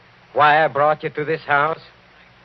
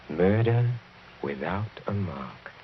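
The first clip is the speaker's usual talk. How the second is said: a soft, gloating murmur; hushed menace; 5 semitones lower, 6 dB quieter, slower than usual. Acted. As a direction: drop it low and quiet, savoring each word. Villain repeats it, relishing.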